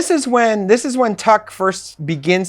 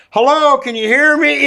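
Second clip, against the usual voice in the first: lightly imitated transmitter voice, pitched up; voice through a mic; 7 semitones higher than usual. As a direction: call out high as if testing a mic. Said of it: "you hear, like, that mic, like".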